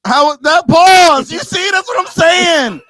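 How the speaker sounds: high, loud protest at the call; incredulous yell; indignant outburst. Real, not acted. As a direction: shout it, outraged at being paused.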